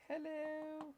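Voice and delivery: very high, drawn-out cheery hello; friendly scale. Acted. Scale greets whoever turns it on, high friendly voice. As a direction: imitate a friendly machine saying hello. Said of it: "The scale is so friendly".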